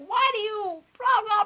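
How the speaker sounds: high, loud, slow mocking voice; mocking people. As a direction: parody the nosy questions. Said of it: "People will be like".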